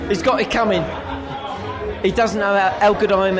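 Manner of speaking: mock challenge shout. Host jokingly calls the boxer out before the crowd, mock shout. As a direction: bellow it like a pub brawler daring him.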